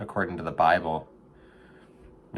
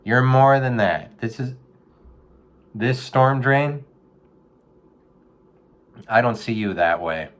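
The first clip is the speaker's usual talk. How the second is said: gentle life-coach advice; 4 semitones higher, 3 dB louder than usual. Retold or imitated. Advising a raccoon in a storm drain, earnest counselor voice. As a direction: talk the raccoon through it like a therapist. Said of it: "I said".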